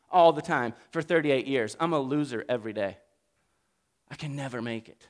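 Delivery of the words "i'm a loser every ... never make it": quiet, hopeless, fast mutter; a beaten man sighing at himself; despairing murmur. Acted. Sick man voiced in despair.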